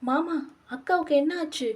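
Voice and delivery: high-pitched, distressed questioning; crying out in alarm. A narrator's reading. Younger sister begs mama for news of her sister, crying out in alarm. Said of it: "என்று கதறியவள்".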